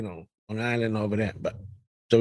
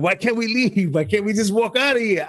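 mock protest; 7 semitones higher than usual. Retold protest while hiding from cops.

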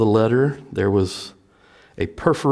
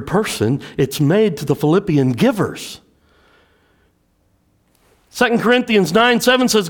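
full-force preaching; 10 semitones higher, 3 dB louder than usual. Acted. Pastor preaching to the church about giving, full force. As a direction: preach it out to the congregation.

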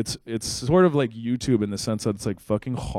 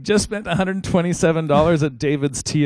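higher, louder imitation of a social media post; mock Facebook poster; 7 semitones higher, 5 dB louder than usual. Host mimicking a Facebook friend's spending post, mock poster. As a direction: lightly parody someone posting their purchase.

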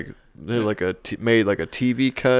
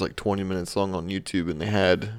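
ordinary talk, nothing marked

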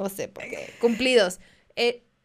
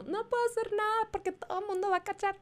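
anxious mock voice; 10 semitones higher, 5 dB quieter, faster than usual. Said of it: "es como".

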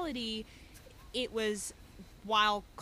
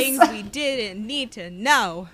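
emphatic; 12 dB louder, much slower than usual. Real, not acted. Reacting to an overshared detail.

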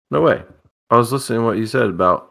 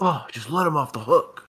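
higher-pitched mock exasperation; imitating exasperated Surgeon; 5 semitones higher, 4 dB quieter than usual. Host guessing the player's reaction to losing, mock exasperated. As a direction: play his frustration after losing. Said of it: "he's gonna be like".

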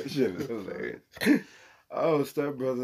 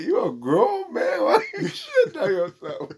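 mock outraged yell; 13 semitones higher, 9 dB louder than usual. Retelling the skit, friend yelling at the robbed man, high and loud. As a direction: yell it high like he can't believe it.

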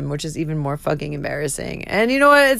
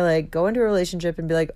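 usual speaking voice

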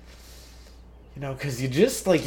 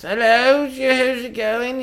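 put-on high, slow sweet old lady voice; a high, drawn-out granny hello; old lady greeting; 11 semitones higher, 5 dB louder, much slower than usual. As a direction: chirp it like a sweet grandma.

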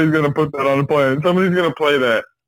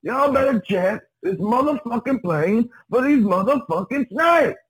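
Samuel L. Jackson impression; 8 semitones higher than usual. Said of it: "I'm Samuel Jackson".

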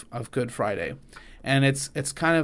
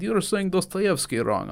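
a light, higher-pitched mock Russian accent; 6 semitones higher than usual. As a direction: tease him in a jokey Russian accent. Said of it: "if a Russian said".

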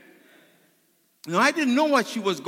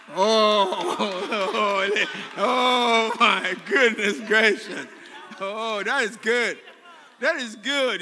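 drawn-out groans of delight; blissful relish; much slower than usual. Acted. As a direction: savour a hug with hammed-up, slow delight. Acts out church enjoying a hug.

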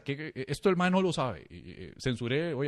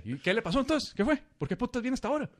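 nosy barber; 9 semitones higher than usual. Retold or imitated. Barber questioning, high.

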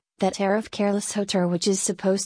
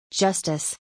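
a touch of scornful exclamation; understated contempt. A narrator's reading. Baron scorns the ex-chancellor's talk of justice, understated contempt. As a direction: toss the word out with mild contempt. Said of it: "with great contempt".

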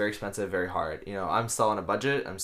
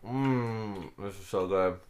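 savoring delicious food; slower than usual. Real, not acted. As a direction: savor the bite, then praise it. Tasting, savoring the bar.

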